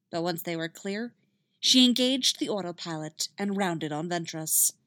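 plain reading voice

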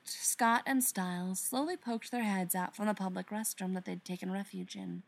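ordinary delivery, nothing marked

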